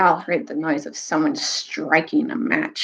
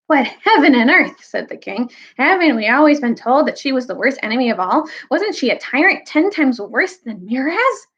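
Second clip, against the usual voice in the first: put-on higher, indignant young king; 6 semitones higher than usual. King challenges the dwarf's praise of the witch, indignant. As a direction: protest with rising disbelief.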